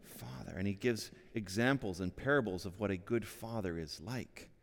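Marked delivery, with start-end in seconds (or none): 0.0-0.5 soft prayer. Shown as a demonstration.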